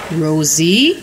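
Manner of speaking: stern. A narrator's reading. Mother confronting daughter over broken truck, low and stern. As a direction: call the child's name with low, restrained sternness. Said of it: "Mommy said sternly".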